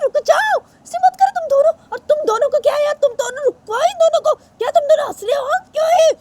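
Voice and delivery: very high, loud shrill mother; frantic mother. Acted. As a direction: break up the fight in panic. Mother stopping a fight. (बहुत ऊँची, घबराई माँ की आवाज़)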